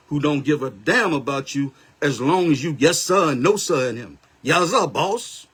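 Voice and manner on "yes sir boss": slow, drawn-out bowing "Yes sir, boss"; sarcastic boss-pleasing drawl; mock servile yes-man. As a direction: play the groveling servant, slowly. Mocking servile follower.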